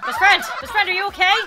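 loud, high concerned call; worried calling out. Real, not acted. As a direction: call urgently to an endangered friend. Calls to a friend in danger.

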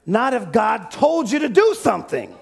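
usual speaking voice

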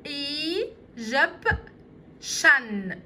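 اي جب شن broken into slow syllables; teacher sounding out Egyptian syllables. Shown as a demonstration. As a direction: spell out اي جب شن slowly, one beat each. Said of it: "تعالوا نشوفهم كده".

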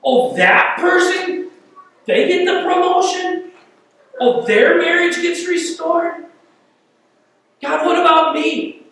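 high, whiny envious complaint; mad at God. Retold or imitated. A believer griping at God, whiny and envious. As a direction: sulk jealously at God's favors. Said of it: "you get mad at God sometimes".

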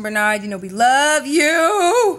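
thanking friend Bernard directly, slow and loud; affectionate shoutout. Real, not acted. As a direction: call out to him with love.

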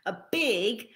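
plain reading voice

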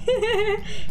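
a quick high giggle; high laugh. Real, not acted.

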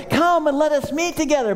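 high, sing-song fake welcome; mock sweet invitation. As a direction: lure him down sweetly. Repeats enemy's invitation.

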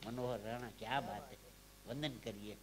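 normal talking voice

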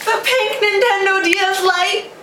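high, thrilled reveal; excited squeal. Real, not acted. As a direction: squeal it out with glee. Big reveal of new console.

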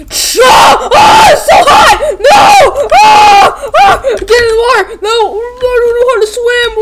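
very high, loud panicked shrieking; burning screams. Retold or imitated. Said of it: "He was like".

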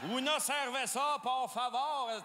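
put-on Spanish in a thick Québécois accent; "Una cerveza, por favor" with no effort at Spanish sounds. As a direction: tourist Québécois ordering beer in Spanish. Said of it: "un Québécois qui parle espagnol".

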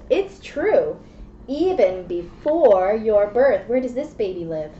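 ordinary talk

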